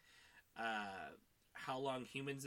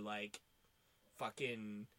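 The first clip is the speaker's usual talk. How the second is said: ordinary talk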